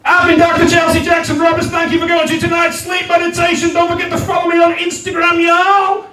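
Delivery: high, brisk sign-off voice; Doctor Chelsea Jackson Roberts. Acted. Meditation host signing off to listeners, quick high patter. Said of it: "And you hear".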